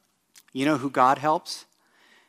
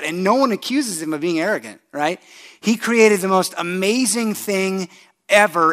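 ordinary talk

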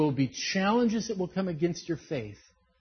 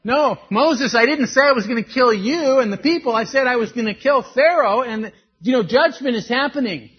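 loud, higher correcting voice; exasperated God; 7 semitones higher, 10 dB louder than usual. Retold or imitated. God correcting Moses, loud and exasperated. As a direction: set him straight with mock exasperation. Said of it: "so far off script".

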